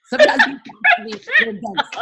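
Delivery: high squealing gangster impression, laughing; mock tough-guy voice, half laughing. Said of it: "my Jesus is gangster".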